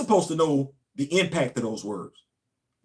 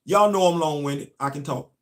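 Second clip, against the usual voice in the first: ordinary talk, nothing marked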